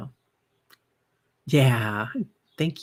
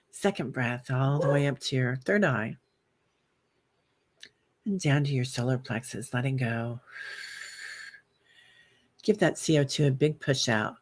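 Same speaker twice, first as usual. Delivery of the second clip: guided breathing meditation. Acted. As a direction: talk the viewers gently through each breath.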